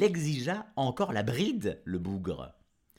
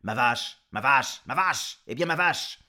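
insistent demand; 6 dB louder than usual. Acted. Jean-Jean réclame sa vache.

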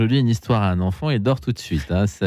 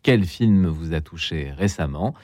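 radio host; 4 semitones lower, 3 dB quieter than usual. Acted. Host opens the radio show.

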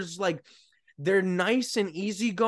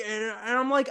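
normal talking voice

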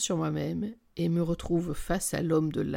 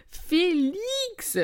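high, long, drawn-out name; excited exclamation; 12 semitones higher than usual. A narrator's reading. Friend reminds Camille who she called about, excited. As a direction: sing the name out at a high pitch.

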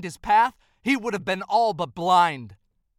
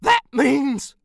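horror; 5 semitones higher, 8 dB louder than usual. Acted. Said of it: "horror gripped Santa's very soul".

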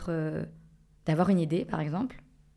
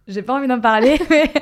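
laughing; 6 semitones higher, 12 dB louder than usual. Real, not acted. Embarrassed, asked about her assets.

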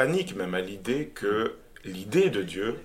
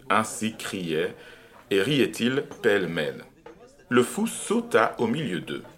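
expressive literary reading with long pauses; dramatic reading; 3 semitones higher, 4 dB louder than usual. Acted. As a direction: read the parable like a stage piece. Reads Nietzsche excerpt aloud.

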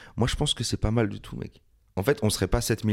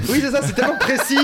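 cracking up mid-sentence, voice pitched way up; laughing; 6 dB louder than usual. Real, not acted. Friend laughs at his oddly precise example, high and giggling. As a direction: laugh through the words.

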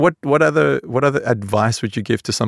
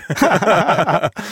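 laughing; 9 semitones higher than usual. Real, not acted. Laughing at own impression.

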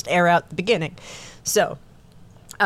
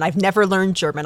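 usual speaking voice

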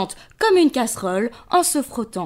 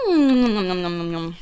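munching sounds; 4 semitones lower than usual. Acted. Lion eating noises.

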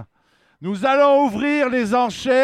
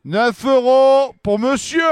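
loud, slow auction calling; auctioneer; 2 semitones higher, 4 dB louder, slower than usual. Acted. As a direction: call the bids out to the room.

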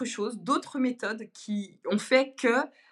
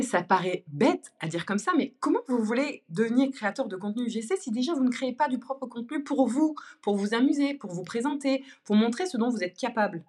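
ordinary delivery

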